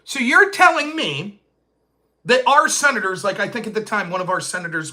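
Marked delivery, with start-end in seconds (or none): 0.0-3.2 can you believe this; incredulous. Retold or imitated. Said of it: "And I said".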